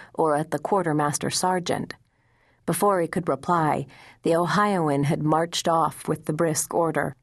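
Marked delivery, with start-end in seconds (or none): none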